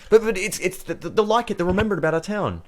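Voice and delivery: high-pitched, stammering eagerness; keen little official, tripping over words; enthused gatekeeper. Acted. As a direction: defend your pet idea excitedly. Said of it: "seems, like, really enthused".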